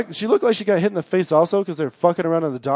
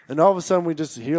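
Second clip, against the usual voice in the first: ordinary delivery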